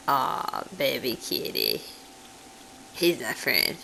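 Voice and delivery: low, soft affectionate murmur; tender cooing. Real, not acted. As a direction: dote softly on a sleepy kitty. Doting on the cat.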